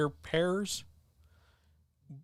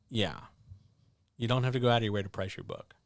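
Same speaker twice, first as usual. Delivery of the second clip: usual speaking voice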